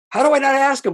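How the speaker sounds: high, loud incredulous retelling; emphatic disbelief. Retold or imitated. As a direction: exclaim it, acting out your own disbelief. Retelling his disbelief afterwards.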